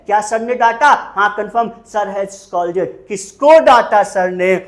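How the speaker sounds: normal talking voice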